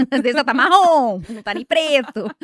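loud burst of comic emphasis; comic exaggeration. Real, not acted. Mother exaggerating about her kid's sneakers, loud and joking.

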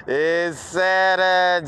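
high, slow shout breaking into laughter; drawn-out cry with a big laugh; gleeful holler. Real, not acted. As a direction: stretch the day out and cackle after. Shouts the day, then laughs.

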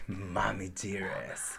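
ordinary talk

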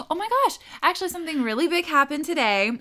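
high, loud, fast gasp of news; gasping excited recall; sudden excitement. Real, not acted. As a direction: burst in with the news. Suddenly remembering big news.

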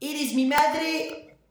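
very high, stretched-out mock anguish; niño dramático a mamá. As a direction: wail the question like a melodramatic child. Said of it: "le decías". (lamento infantil exagerado, agudo y alargado)

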